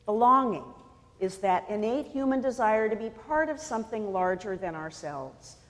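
ordinary delivery

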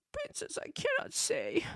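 put-on high, soft little boy's voice; tearful mumble. Crying boy answers the princess, soft high tearful mumble. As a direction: mumble it through tears like a small boy. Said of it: "tearfully mumbled".

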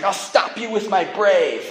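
put-on high, slow child's battle cry without shouting; brave little daughter. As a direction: imitate a small girl heroically defending her sister. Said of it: "she jumps in there, and she's like".

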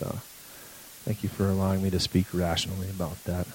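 soft, reverent pastor's prayer; quiet sermon-ending prayer; closing prayer. Acted. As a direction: pray over the congregation gently.

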